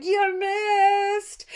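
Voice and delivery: high, loud taunting yell; taunting shout. Retold or imitated. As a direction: jeer it up high and loud at the giant. Odysseus taunts the giant.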